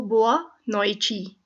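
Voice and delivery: "बोआ नॉइची" said slowly and clearly; slow pronunciation demo. Shown as a demonstration. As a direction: spell "नॉइची" out for the class, ending in chi. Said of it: "night को कहते हैं नॉइची".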